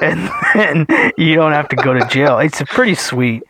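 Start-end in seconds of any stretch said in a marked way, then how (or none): 0.0-2.4 laughing. Pretend. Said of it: "For your best accent yet".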